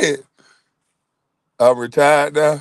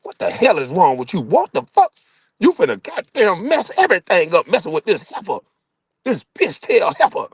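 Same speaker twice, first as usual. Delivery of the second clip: cussing mama; 8 semitones higher than usual. Retold or imitated.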